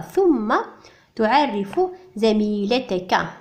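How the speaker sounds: ordinary talk, nothing marked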